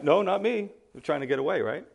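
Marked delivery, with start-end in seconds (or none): 0.0-0.7 higher-pitched mock denial; lightly acted innocent brush-off; feigned innocence. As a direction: play the escapee trying to slip through. Escapee denies being an Ephraimite.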